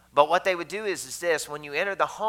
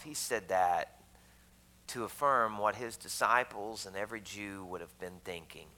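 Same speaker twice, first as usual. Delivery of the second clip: ordinary talk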